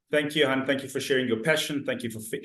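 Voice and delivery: ordinary talk, nothing marked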